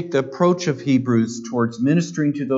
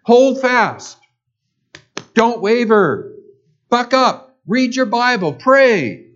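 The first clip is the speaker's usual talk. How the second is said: higher and louder, stern orders; barked commands; 8 semitones higher, 5 dB louder, slower than usual. Retold or imitated. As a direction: snap out short commands. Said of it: "sort of issuing commands".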